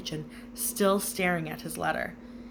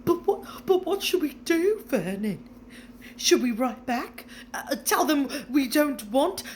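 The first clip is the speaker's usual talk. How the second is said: higher, near tears; anxious pleading; 7 semitones higher, 4 dB louder than usual. A narrator's reading. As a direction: beg her husband for a plan. Petunia panics about what to do.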